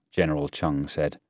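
usual speaking voice

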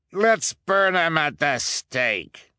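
high, slow eager shout; eager cry. Acted. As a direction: call for the burning gleefully. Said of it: "cried a voice".

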